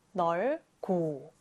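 넓고 drawn out slowly and softly; careful slow model of 넓고; slow careful demo. Shown as a demonstration. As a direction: sound out 넓고 syllable by syllable, slow and soft. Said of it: "이렇게 읽으면 되는데요".

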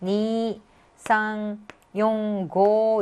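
"니" and "고" held long; each digit separate, vowels of "니" and "고" drawn out; long-vowel digit reading. Shown as a demonstration. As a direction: read digit by digit, stretching "니" and "고". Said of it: "이렇게 읽어준다고요".

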